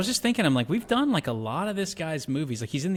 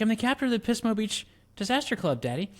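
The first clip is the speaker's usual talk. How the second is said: put-on much higher teen-girl voice; quoting Cher; 10 semitones higher than usual. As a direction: play the earnest daughter explaining to Daddy. Acting out Cher answering her dad.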